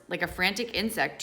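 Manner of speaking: plain reading voice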